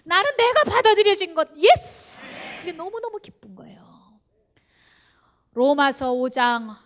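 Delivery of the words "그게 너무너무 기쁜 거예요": soft whispered confession of joy. Real, not acted. Preacher sharing his joy with the congregation, whispered. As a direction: breathe it like a secret.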